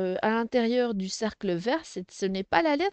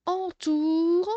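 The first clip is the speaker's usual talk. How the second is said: "entoure" drawn out in two long, higher syllables; careful reading; 5 semitones higher than usual. Shown as a demonstration. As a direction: stretch "entoure" as if reading to beginners. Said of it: "Je lis bien la question".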